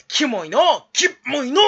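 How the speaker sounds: loud, high drawn-out exclamation; mock disgust. Streamer reacts to the silly quote, loud mock disgust. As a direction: stretch it into a comic yell.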